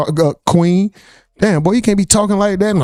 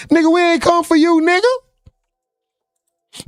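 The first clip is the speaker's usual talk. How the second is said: mock call-out; 10 semitones higher, much slower than usual. Real, not acted. Mock calling out the host.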